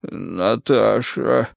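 very slow, drawn-out murmur; dazed, dragging mumble; feeble babbling. A narrator's reading. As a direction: babble it half-conscious, eyes closed. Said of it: "пролепетал он с закрытыми глазами".

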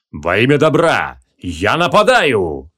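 slow, drawn-out battle cry; paladin's charge cry, stretched out word by word; боевой клич. A narrator's reading. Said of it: "раздался боевой клич паладина". (протяжный боевой клич)

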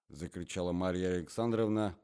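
usual speaking voice

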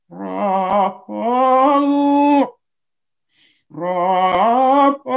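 Raphaël drawn out long on the breath; breath-paced pronunciation. Shown as a demonstration. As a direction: stretch Raphaël slowly with the respiration. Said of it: "avec la respiration".

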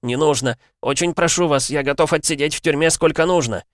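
high, loud lamenting plea; wailing plea. A narrator's reading. Kneeling constable wails, begging for prison instead. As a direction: wail and beg, voice cracking up. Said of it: "запричитал он".

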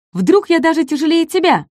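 high, excited small voice; eager little dinosaur. Acted. Ком зовёт Дака взвеситься, радостно. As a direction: pitch the idea with glee.

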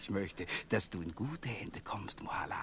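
soft, low, whispered affection; tender whisper. Acted. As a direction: whisper it like a farewell blessing.

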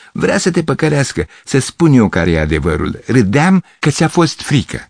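put-on high, laughing girl's voice; mock him like a giggling girl. Girl laughing at Gavrilescu, high and mocking.

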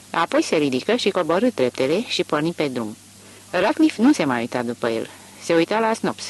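ordinary delivery, nothing marked

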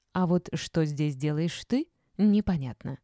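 ordinary delivery

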